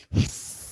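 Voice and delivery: a quick, playful snake hiss. Pretend. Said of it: "we'll hiss".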